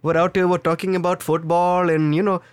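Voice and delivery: chatty call-centre patter in an Indian accent. Shown as a demonstration. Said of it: "My Indian accent is my favorite accent".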